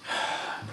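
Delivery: long unvoiced exhale; deep sigh. Real, not acted. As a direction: breathe out slowly. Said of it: "Breathing at this point".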